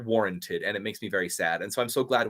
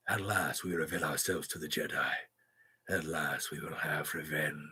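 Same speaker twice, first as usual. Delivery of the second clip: low, hushed sinister voice; quiet, deep menace, savoring each phrase; Darth Maul; 8 semitones lower, 11 dB quieter than usual. Acted. Impersonating a Sith plotting revenge on the Jedi, sinister. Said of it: "I think Darth Maul is hard to impersonate".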